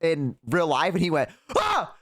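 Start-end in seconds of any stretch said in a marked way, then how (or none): 1.6-1.9 very high imitated "ah"; scared yelp. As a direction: mimic a friend's startled cry. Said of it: "Like that".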